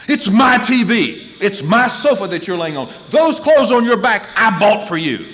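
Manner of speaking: loud, high angry parent rant; shouted indignation, acting out own tirade; possessive outburst. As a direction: hammer each claim of ownership. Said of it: "you know what I sounded like".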